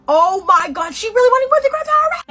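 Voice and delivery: shrieking high and loud; very high, loud shocked outburst. Retold or imitated. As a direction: gasp it out in disbelief.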